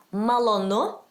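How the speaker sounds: Malonu modelled slowly for a learner, end rising; accented Malonu. Shown as a demonstration. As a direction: say Malonu carefully with a lifted ending. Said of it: "The accent is there".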